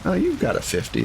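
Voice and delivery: ordinary delivery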